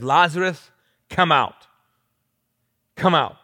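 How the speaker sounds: loud, commanding call; Jesus shouting. Acted. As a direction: summon him forcefully, each word landing hard. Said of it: "Then Jesus shouted".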